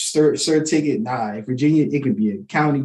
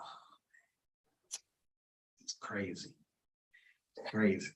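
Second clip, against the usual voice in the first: whispered, much softer nervous mutter; 5 semitones lower, 19 dB quieter than usual. Retold or imitated. Driver pulled over by officer, worried whisper. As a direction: breathe it out under your breath. Retelling nervous self at stop.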